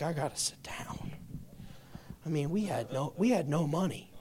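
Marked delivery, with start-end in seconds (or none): 0.0-1.1 whispered, overwhelmed; stunned whisper. Retold or imitated. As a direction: whisper it like the news knocked you off your feet. Retold shock, whispered.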